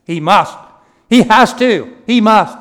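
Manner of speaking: very loud, insistent bursts; forceful pulpit shout, punched word by word; emphatic shout. Shown as a demonstration. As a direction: shout each short phrase as an absolute demand. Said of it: "I'm putting the emphasis on".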